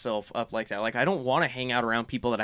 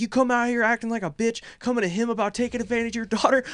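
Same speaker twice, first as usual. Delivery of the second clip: furious yelling; 9 semitones higher, faster than usual. Retold or imitated. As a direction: let her have it, voice pitched up. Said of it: "Just went off".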